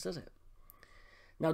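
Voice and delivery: ordinary talk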